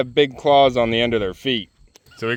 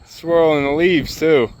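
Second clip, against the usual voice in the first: ordinary talk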